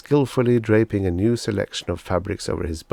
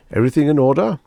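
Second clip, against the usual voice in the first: louder, beaming salesman flattery; gushing salesman; 7 semitones higher, 8 dB louder, faster than usual. Acted. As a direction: lay the praise on thick. Salesman Pathis asks.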